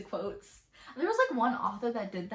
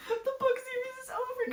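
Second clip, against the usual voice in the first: hammed-up fake crying, much higher; mock wailing. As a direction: wail it like a melodramatic heartbroken fan. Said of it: "I'm gonna call y'all and be like".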